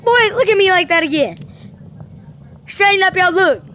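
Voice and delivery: hammed-up parental scolding; mock scolding. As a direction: scold him like a strict elder. Scolding someone in game.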